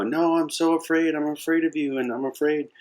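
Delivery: high, frightened little cub voice; terrified lion cub. Acted. Cornered cub to the adult lion, terrified. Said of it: "reared back in terror".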